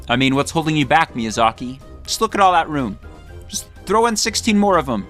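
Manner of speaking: usual speaking voice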